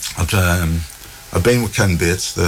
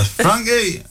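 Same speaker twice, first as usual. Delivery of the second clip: mum calling out. Retold or imitated. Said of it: "she shouted for me father".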